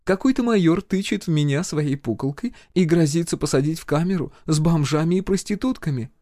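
high-pitched, sweetly aggrieved healer; smiling complaint. A narrator's reading. Healer complains to her friend about the major, smiling. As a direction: tattle on him in a sugary voice. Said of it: "продолжала улыбаться Надежда Владимировна".

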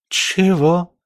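резкое, высокое ошарашенное переспрашивание; воскликни, будто совсем обалдела; ошарашенно. A narrator's reading. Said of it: "даже обалдела Юля". (ошарашенно, на высоких нотах)

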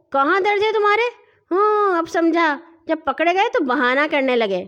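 scornful bully; bullying reproach. Acted. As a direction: dismiss his complaint roughly. Bully mocks the boy's complaint.

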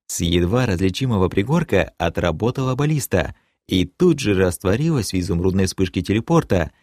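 usual speaking voice

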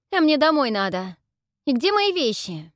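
high loud demand; girl yelling for her belongings. Acted. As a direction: shout for her things.

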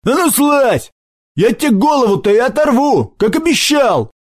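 high, furious threat; angry cry. A narrator's reading. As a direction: threaten the boy up the tree in a rage. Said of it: "зло вскрикнул Сашок".

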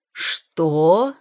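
loud, rising disbelief; stunned. A narrator's reading. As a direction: react in shock to the news. Husband hears news of pregnancy. (ошеломлённо)

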